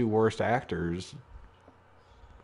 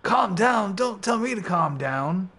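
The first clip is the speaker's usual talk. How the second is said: high, louder mock wrestler yell; 9 semitones higher, 4 dB louder, slower than usual. Wrestler snapping at his rival to calm down, mock yell. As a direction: shout it like a wound-up wrestler. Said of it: "He goes".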